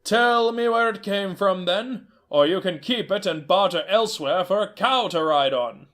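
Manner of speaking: a stern trader laying down terms; stern horse trader. Acted. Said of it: "stern old horse trader".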